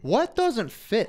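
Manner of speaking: slow, high, disbelieving repeat; incredulous. Real, not acted. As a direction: drag it out in mock disbelief. Incredulous at the team quote.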